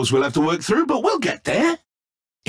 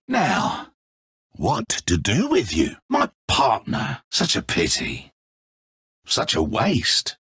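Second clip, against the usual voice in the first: menacing pity; 10 semitones lower, 3 dB quieter than usual. Acted. Villain gloats over captured partner.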